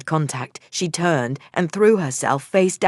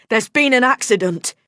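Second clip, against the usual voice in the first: shout; 6 semitones higher, 3 dB louder than usual. A narrator's reading. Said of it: "I shouted".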